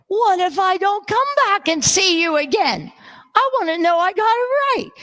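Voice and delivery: high, hurried mock pleading; mock insistent plea. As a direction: plead with the cashier, hammed-up and high. Acts own insistent plea to cashier.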